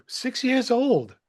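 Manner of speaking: high, drawn-out disbelief; amazed. Real, not acted. Host marvelling at the boy's age, amazed.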